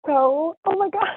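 choked-up, emotional thanks; fighting back happy tears; teary voice. Real, not acted. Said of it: "I got kinda teary over here".

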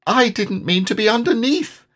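high, small, sad squeak; piping little voice with sadness. A narrator's reading. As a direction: plead it sadly in a tiny voice. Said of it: "said Piglet sadly".

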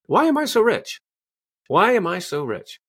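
high, wondering question; empowering question. Shown as a demonstration. Coach demonstrating the question form, high and curious. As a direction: ask it as if truly curious. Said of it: "you ask a question".

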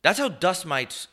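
mock lecturing. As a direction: warn him like a know-it-all. Mock warning about dust mites.

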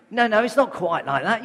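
laughing loudly as he takes the joke back. Real, not acted. Preacher undoing his own joke to the church, laughing out loud. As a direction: laugh through the denial.

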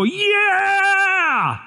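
high, whooping stadium cheer; touchdown cheer. Retold or imitated. Preacher imitates fans cheering a touchdown, whooping high. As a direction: yell it like the Aggies just scored. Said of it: "What do we go? We go".

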